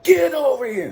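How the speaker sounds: high, loud commander holler; mock barked order. Commander summons the sergeant, barked mock order. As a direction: call the sergeant over in an imitated yell. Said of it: "he points to the sergeant".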